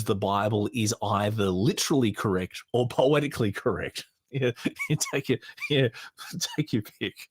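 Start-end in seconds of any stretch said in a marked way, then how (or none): 4.3-7.2 amused giggles breaking up the words; giggling. Real, not acted. Podcaster amused by apologists' logic, giggling as he talks. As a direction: talk through a suppressed giggle. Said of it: "So I'm giggling a bit".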